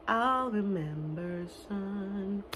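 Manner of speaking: very slow, very soft sung line; soft slow hint of the sad song; hushed song quote. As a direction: breathe the lyric out softly.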